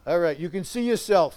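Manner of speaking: ordinary talk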